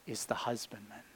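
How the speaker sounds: hushed whisper, almost no voice. Acted. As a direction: breathe the words like a secret. Jesus's words hushed mid-quote.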